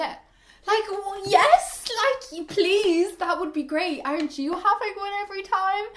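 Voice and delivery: high, loud, sassy retort; indignant sass. Real, not acted. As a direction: fire back at him with mock outrage. Indignant reply to a man.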